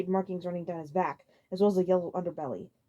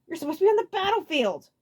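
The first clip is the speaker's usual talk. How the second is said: Abrax voice; 10 semitones higher, 6 dB louder than usual. A narrator's reading.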